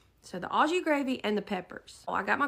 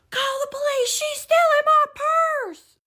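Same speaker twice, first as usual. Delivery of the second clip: imitated screaming; 8 dB louder than usual. Woman accusing shopper of theft, imitated screaming. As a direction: scream the accusation, stretching the end. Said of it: "He started screaming".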